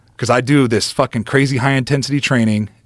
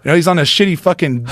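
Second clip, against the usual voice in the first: usual speaking voice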